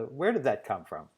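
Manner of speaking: usual speaking voice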